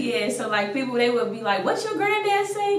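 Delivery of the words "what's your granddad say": high-pitched mock Caribbean accent; a kid teasing in a Caribbean accent. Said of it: "that strong Caribbean accent".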